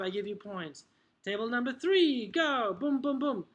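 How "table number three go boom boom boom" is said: higher, louder drill starter; calling on pupils. Acted. Teacher starting table three's drill, calling out. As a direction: set the team off with punchy energy.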